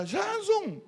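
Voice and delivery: high, loud call across the arrivals hall; calling out a name. Retold or imitated. A stranger at the airport calling out to the arriving pastor. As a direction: shout it from far off to get his attention.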